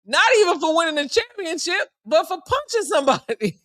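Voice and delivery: high-pitched, incredulous punchline; amused disbelief. Real, not acted. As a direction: pitch it up, laughing right after. Jokes about player's absurd TMZ fame.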